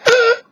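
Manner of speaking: sudden high cracked yelp; strained chest-cold voice breaking high; voice cracking squeak. Shown as a demonstration. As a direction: push the voice up until it cracks into a squeal. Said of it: "if I raise my voice, it goes".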